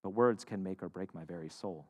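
quiet, slow quoted saying; solemn quote. Acted. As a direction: read it slowly, letting it sink in. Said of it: "summed up this idea this way".

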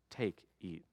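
hushed words at the table; solemn quiet. Retold or imitated. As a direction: say it hushed, like a blessing. Jesus breaks bread at dinner.

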